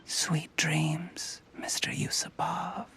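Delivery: soft unvoiced farewell; villain whispering to his victim as he dies; sinister whisper. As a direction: whisper a cold goodbye to the dying man.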